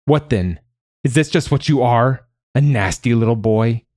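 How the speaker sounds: angry taunting. Acted. Mother taunting her nasty little boy, angry. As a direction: taunt the child with sharp questions.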